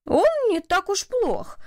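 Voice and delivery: high, drawn-out mild protest; objecting. A narrator's reading. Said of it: "возразил Коллинз".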